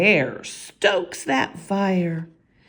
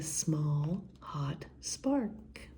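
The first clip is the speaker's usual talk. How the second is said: slow hush; 3 semitones lower, 11 dB quieter, much slower than usual. A narrator's reading.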